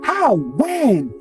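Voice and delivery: high-pitched shock, falling on each word; startled, high-pitched questioning. A narrator's reading. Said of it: "gasped in shock".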